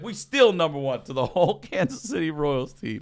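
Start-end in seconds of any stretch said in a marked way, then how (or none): 0.0-1.0 raised, higher heckler's holler; imitated taunt, moderately loud; retold defiant yelling. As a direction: repeat the boast loudly, standing your ground. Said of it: "he's yelling up at the bus".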